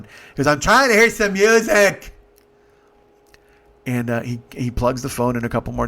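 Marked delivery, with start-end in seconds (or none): 0.5-2.1 high, loud mock whine; exasperated complaint. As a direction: imitate an indignant passenger calling out. Retold passenger snapping in complaint.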